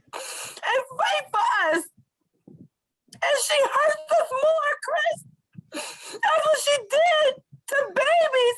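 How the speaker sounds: real tearful shouting at a betrayal; crying outcry. Real, not acted. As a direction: sob it out in raw anger. Tearful outcry against Diane's betrayal.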